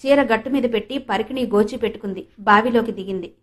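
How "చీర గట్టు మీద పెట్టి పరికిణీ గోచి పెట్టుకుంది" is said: usual speaking voice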